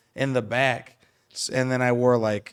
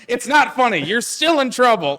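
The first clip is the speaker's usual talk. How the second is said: higher, louder mom scolding while laughing; mock stern mother, higher and louder; 11 semitones higher, 8 dB louder than usual. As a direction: play mom trying to stay stern. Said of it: "she was like".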